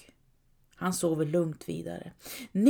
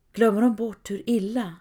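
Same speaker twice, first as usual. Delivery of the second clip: usual speaking voice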